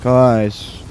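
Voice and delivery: mocking a listless line reading; deadpan mimicry of a game character; unenthusiastic. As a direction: drag it out with zero enthusiasm. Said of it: "couldn't say that with any less enthusiasm".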